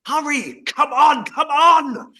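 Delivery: high, loud urgent yelling; urgent shout. Acted. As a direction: shout them on, desperate. Urging plane landing.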